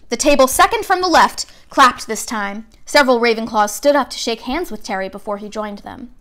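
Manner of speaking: plain reading voice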